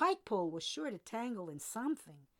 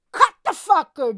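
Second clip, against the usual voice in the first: very loud, higher bellow; 7 semitones higher, 23 dB louder than usual. A narrator's reading. Jordan ordering the deckhand to cut the line, bellowing. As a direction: bellow it over the storm. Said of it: "Jordan bellowed".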